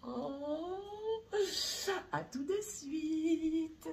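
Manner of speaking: cutesy voice. Acted. Signing off in a cute voice.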